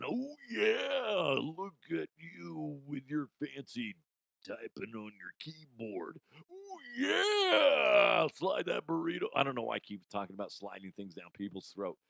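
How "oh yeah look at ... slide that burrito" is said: hammed-up wrestler promo; Macho Man impression. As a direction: yell oh yeah like the Macho Man. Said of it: "Macho Man likes to watch".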